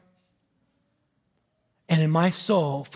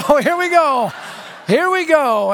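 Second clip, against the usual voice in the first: high-pitched, raised-voice reaction; alarmed bracing; 7 semitones higher than usual. Retold or imitated. Preacher to himself as more vodka arrives, high alarmed reaction.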